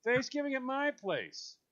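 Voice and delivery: lightly voiced welcoming neighbour; inviting hostess. Neighbour [name] inviting you over, lightly voiced cheery hostess. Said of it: "tells you guys".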